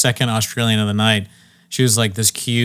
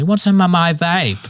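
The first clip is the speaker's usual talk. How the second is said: higher, sugary put-on offer; mock over-friendly; 7 semitones higher than usual. Stranger offering her vape, in a higher, too-friendly mock voice. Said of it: "like, way too friendly".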